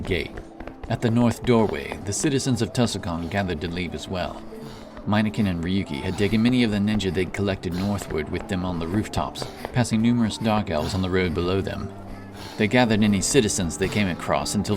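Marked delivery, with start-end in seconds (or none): none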